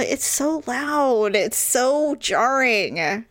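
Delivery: slow, drawn out on each "so"; emphatic complaint. Real, not acted. Wife justifying anger at sneezes to friend, emphatic complaint. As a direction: stretch the words to press the point.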